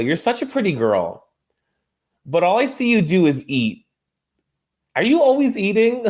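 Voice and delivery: sweet-then-savage dig, laughing through it; high teasing impression of Mary needling Monica; mock Mary jab. Quoting Mary's jab at Monica.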